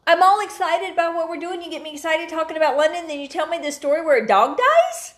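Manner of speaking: high, loud, rising to an incredulous question; indignant complaint. Real, not acted. Co-host upset that a London story turned sad, indignant complaint.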